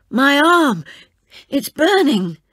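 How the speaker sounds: loud, high pained shout; agonized cry. Acted. Poisoned soldier cries out about his arm, loud pained shout. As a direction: cry out in burning pain.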